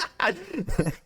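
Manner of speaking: high-pitched burst of laughter; real laugh. Real, not acted. Laughing at a forty degree fever confession, high giggle. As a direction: crack up helplessly for a second.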